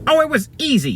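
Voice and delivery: high, smug put-on villain voice; gloating bad guy. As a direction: boast in a high character voice.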